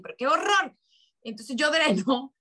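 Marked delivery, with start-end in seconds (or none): 0.0-0.8 sudden high-pitched, louder outcry of frustration; exasperated complaint. Retold or imitated. Woman retelling how she argues with Jesus, exasperated outcry. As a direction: throw up your hands and wail it at the heavens. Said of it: "peleo con Jesús y le digo".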